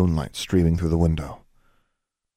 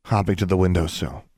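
ordinary delivery

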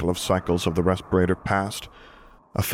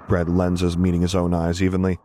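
ordinary delivery

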